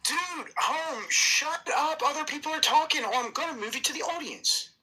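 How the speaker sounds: high-pitched, exasperated order. Real, not acted. Host threatening a talker with removal, exasperated. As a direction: cut in with a high, fed-up warning. Heated row, ordering someone quiet.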